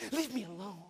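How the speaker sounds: low, soft dismissive mumble; sullen teen. Retold or imitated. Teenage daughter on her phone brushing off her parent, low and soft.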